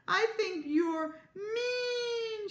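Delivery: high, drawn-out wail; hammed-up tearful whine; crying. A narrator's reading. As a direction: wail it slowly, stretching the last word. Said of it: "began to cry".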